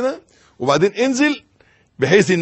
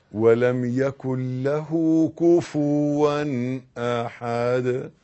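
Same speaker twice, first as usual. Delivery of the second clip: slow recitation; 3 semitones lower, much slower than usual. Shown as a demonstration. Preacher reciting Al-Ikhlas for worshippers. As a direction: recite it slowly and gently. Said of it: "اقرأ سورة".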